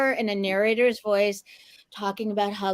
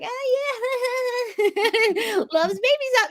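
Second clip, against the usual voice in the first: put-on much higher, laughing kid voice; imitating kid viewer; 13 semitones higher, slower than usual. Recalling watching the commercial as a kid, mock-naive high voice. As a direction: mimic a naive young TV watcher, high-pitched. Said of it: "we watched this, just like".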